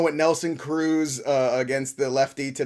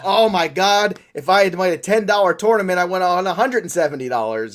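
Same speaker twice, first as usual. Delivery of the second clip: louder, higher mock exclamation; excited outburst; 4 semitones higher, 5 dB louder than usual. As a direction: blurt it out like an overexcited winner. Acting out a winner's regret.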